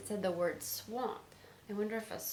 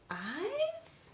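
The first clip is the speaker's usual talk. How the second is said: much higher, puzzled slow question; sudden surprise; 9 semitones higher, much slower than usual. A narrator's reading. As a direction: rise in alarm at the eyes. Frog spots the eyes.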